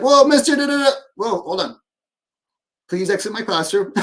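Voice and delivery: a high, loud fumbling start, then it settles; playfully sputter, then calmly send them out; mock exasperated teacher. Teacher to forgetful student, hammed-up exasperated sputter.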